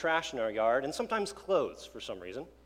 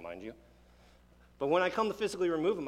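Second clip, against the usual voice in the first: ordinary delivery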